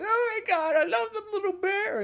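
high, loud mock child voice; excited little kid. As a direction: squeal it like a kid adoring a bear. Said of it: "little kid".

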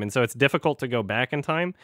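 ordinary talk

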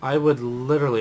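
ordinary delivery

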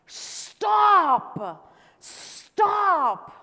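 high, loud shouted demand; shouting. Acted. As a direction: holler it, long and drawn out. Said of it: "but he shouted even more".

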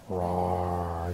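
low, soft pretend roar; dinosaur roar. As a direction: draw out a gentle, deep dinosaur roar. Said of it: "dinosaur says".

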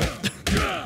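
high giggle. Real, not acted. Reacts after taking the meat.

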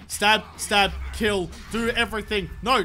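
ordinary talk

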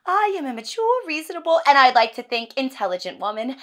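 high, loud self-justification; indignant insistence. Acted. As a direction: protest your own good sense loudly. Wife insists she is sane.

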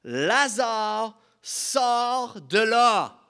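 big, drawn-out commanding shout; loud cry. A narrator's reading. Preacher as Jesus calls Lazare from the tomb, crying out. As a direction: call the dead man out at full voice. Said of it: "il cria d'une voix forte".

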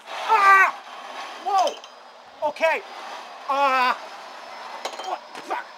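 high, loud startled yell of delight; screaming through the sudden drop; thrilled scream. Real, not acted. Riding the playground zip ride.

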